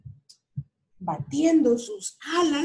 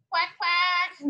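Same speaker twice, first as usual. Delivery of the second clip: high quacking duck; 6 semitones higher than usual. Acted. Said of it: "dijo el pato". (graznido de pato)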